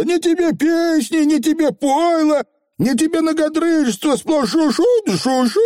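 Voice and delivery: очень высокая жалоба коротышки; перечисляй обиды высоким голосом; возмущённый фигль. A narrator's reading. Фигль жалуется на скучные поминки. (возмущённый фигль)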